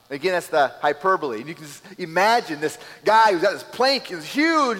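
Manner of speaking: ordinary delivery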